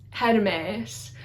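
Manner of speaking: "Hermes" as her-MEHS, tapped r; short first e, long drawn second e in "Hermes"; Greek pronunciation. Shown as a demonstration. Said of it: "second E is a long E".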